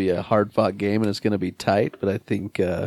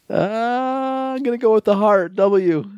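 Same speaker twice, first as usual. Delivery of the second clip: high, fast excited pick; 12 semitones higher than usual. Real, not acted. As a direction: burst out with the heart pick. Decides with the heart.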